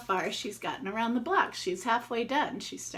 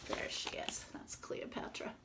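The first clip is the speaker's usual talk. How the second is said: hushed whisper; 12 dB quieter than usual. Real, not acted. Aside to kids showing picture.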